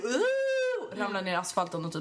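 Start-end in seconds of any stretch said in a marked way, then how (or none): 0.0-1.0 sudden high cry of falling; high yelp. Retold or imitated. Retelling her fall, a sharp high yelp. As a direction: yelp as you hit the ground. Retold fall onto asphalt.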